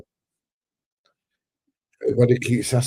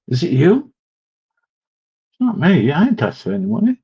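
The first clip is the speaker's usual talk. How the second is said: indignant denial; 8 semitones higher, 6 dB louder than usual. Real, not acted. Accused of asking for money.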